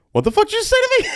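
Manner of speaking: squeaky high outraged disbelief. Retold or imitated. DJ stunned by the promoter's news, squeaky high disbelief. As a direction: shoot way up in pitch, stunned. DJ's outraged retort retold.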